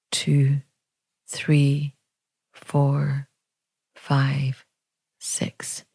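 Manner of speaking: hushed, evenly spaced counting, fading at the end; soft meditation count. Real, not acted. Teacher counts an out-breath for meditators, softly and evenly.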